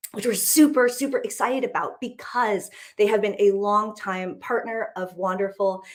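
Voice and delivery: ordinary talk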